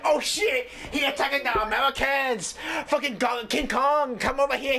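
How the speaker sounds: hammed-up mock Japanese accent; shouted fake Japanese accent. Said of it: "me doing a horrible Japanese accent".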